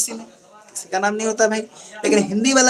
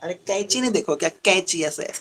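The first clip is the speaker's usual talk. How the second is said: normal talking voice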